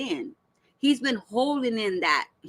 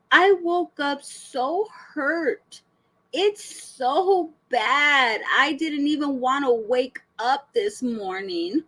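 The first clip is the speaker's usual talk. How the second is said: very slow, higher-pitched melodrama; mock anguish; 3 semitones higher, slower than usual. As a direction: stretch her words into a hammed-up lament. Mocking reading of her dramatic post.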